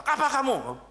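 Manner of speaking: high, loud hostile snap; a warrior chief snapping at his rival; fierce challenge. Retold or imitated. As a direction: confront him like a warlord. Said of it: "Kalau Abraham kan galak".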